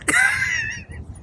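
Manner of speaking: a short, high burst of laughter. Real, not acted. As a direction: break into a laugh. Laughs after fly joke.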